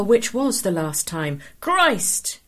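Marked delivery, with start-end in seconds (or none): 1.6-2.4 sudden high-pitched outburst; loud exclamation. A narrator's reading. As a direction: blurt it out loudly, without thinking. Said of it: "he exclaimed loudly without realizing it".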